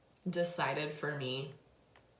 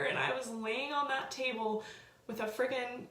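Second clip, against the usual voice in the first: high, teary, shaky-voiced telling; talking through tears, overcome; tearful; 8 semitones higher than usual. Real, not acted. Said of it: "I'm sorry to get emotional".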